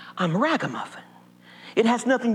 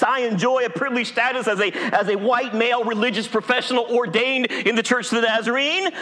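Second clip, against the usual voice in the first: ordinary delivery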